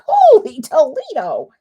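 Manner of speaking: loud, bright exclamation of surprise; holy toledo surprise. Retold or imitated. Crafter reacting to the viewer count, loud surprised exclaim. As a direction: blurt it out in delighted shock. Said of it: "and I'm like".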